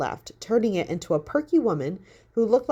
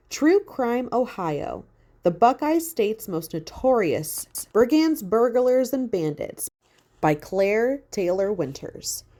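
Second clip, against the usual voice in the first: slow, higher, announcing the title; dramatic title read; 4 semitones higher, slower than usual. A narrator's reading. Haley reads the found book title, slow and dramatic. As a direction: read it like a movie trailer.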